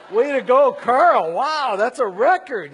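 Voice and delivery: loud, high mock praise; big sarcastic "well done"; sarcastic congratulations. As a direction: exclaim it like an exasperated cheer for a blunder. Mock-congratulating his friend in retold thoughts.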